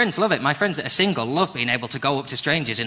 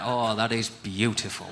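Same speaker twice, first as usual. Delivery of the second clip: slow, low, soft rural northern admiration; farmer-like northern accent; 6 semitones lower, 7 dB quieter, slower than usual. Shown as a demonstration. Complimenting a lady like livestock, slow northern farmer accent. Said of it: "sounds like I'm talking about livestock".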